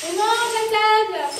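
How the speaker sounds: loud, long-drawn dinner call. Acted. Mother calls the family to the table, calling out. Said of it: "T'as pas entendu ta mère appeler".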